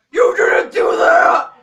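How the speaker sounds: angry shouted complaint; mock yell. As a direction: shout it like an upset viewer. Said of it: "yell at me and be like".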